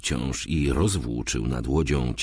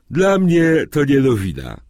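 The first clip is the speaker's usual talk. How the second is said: high, loud yawned reply; yawning; 10 semitones higher, 7 dB louder than usual. A narrator's reading. Sleepy flatmate answers that it is no news, yawning. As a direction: talk through a stretched-out yawn. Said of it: "odpowiedział ziewając".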